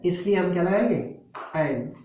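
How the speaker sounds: ordinary delivery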